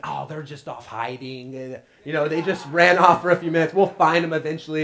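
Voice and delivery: mock reassurance. Mock reassurance about hiding pets.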